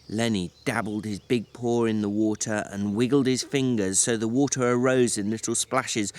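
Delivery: plain reading voice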